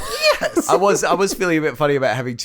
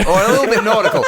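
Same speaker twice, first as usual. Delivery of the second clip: laughing; 8 semitones higher, 7 dB louder, much faster than usual. Real, not acted. Laughing aside.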